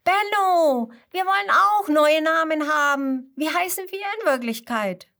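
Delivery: high, loud eager flock voices; sheep calling eagerly. A narrator's reading. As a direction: beg Benno for new names. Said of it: "Und dann rufen alle restlichen Schafe". (hohe, laute, eifrige Schafrufe)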